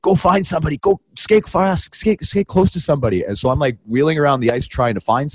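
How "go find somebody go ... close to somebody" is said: high, urgent mock producer orders; high-pitched insistent commands; frantic producer. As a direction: imitate a producer urging in the earpiece. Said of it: "my producer in my ear is like".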